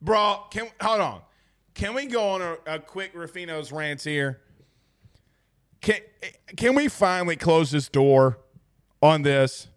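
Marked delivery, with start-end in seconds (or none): none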